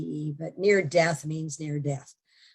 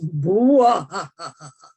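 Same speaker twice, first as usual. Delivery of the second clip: loud imitated villain cackle; hammed-up menacing laugh; Bela Lugosi horror laugh; 9 dB louder than usual. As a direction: boom out a mock horror-movie evil laugh. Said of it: "horrible laugh that comes from a, you know, Bela Lugosi movie".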